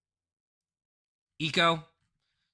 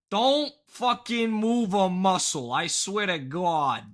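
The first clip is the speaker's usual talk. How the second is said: mock threat; 6 semitones higher, 6 dB louder, slower than usual. Jokingly threatens chat before break.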